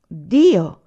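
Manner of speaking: explosive loud cry; outburst. A narrator's reading. Anania breaks out beating his chest, explosive cry. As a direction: burst out with the word. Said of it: "proruppe Anania".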